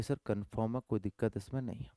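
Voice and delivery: soft, quick pupil's answer; teacher voicing the class, soft and quick; soft student reply. Shown as a demonstration. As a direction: rattle it off quietly like a student. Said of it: "बोलोगे कि".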